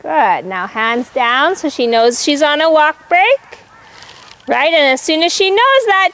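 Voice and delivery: normal talking voice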